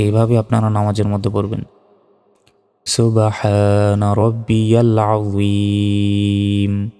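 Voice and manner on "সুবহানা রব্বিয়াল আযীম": very slow, chanted tasbih; slow Quranic recitation. Shown as a demonstration. Teacher to worshippers, slow chanted recitation. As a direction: recite it slowly with held vowels. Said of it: "আমি যেভাবে পড়াচ্ছি".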